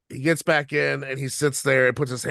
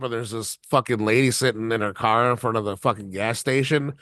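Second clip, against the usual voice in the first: ordinary talk, nothing marked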